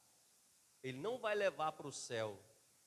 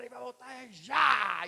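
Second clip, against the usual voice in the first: sudden huge shout, much higher and louder, slow; 10 semitones higher, 16 dB louder, slower than usual. Retold or imitated. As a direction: explode on já to startle the church. Pastor scares locked-in church. (grito repentino e forte no já)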